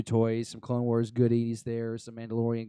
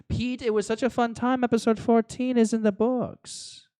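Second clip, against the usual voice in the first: hammed-up German accent, much higher and slower; over-the-top fake German accent, much higher pitch; bad German accent; 12 semitones higher, 5 dB louder than usual. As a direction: channel a German, badly and on purpose. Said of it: "That was the worst German accent ever".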